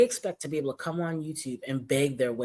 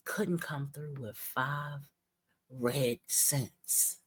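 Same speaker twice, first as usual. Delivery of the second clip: drawn out and softer, mocking; slow, softer mocking put-down; 3 semitones lower, 6 dB quieter, much slower than usual. Shown as a demonstration. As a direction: drag it out in scorn. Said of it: "mocking the streamer's money hopes".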